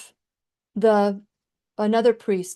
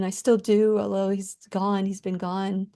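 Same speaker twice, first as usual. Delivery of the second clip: ordinary talk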